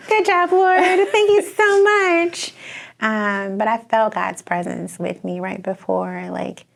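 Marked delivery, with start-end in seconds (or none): 0.0-2.5 high, loud, laughing retold relief; hammed-up jubilant praise through laughter; laughing thanks. Jokingly retells relieved thanks on waking.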